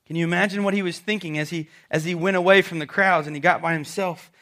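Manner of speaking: ordinary talk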